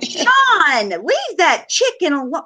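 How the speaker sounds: high, slow mock plea to leave the chicken alone; teasing plea. Real, not acted. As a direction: beg him playfully to spare the chicken. Teasing a friend on video.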